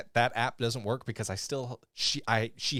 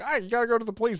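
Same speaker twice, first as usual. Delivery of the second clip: high, loud acted urging; pitched-up, pressing character line; urgent plea; 12 semitones higher, 5 dB louder, much faster than usual. Acts Bonnie's dialogue in recap.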